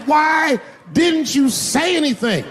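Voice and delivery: high, drawn-out yelled question; shrill outraged cry. Retold or imitated. Comic to his dance partner, yelling high in outrage. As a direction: wail it in outrage.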